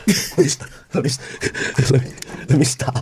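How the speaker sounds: low, unvoiced and hushed; breathless whisper. Real, not acted. Trying to stop himself after yelling, whispering low. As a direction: catch your breath in a whisper.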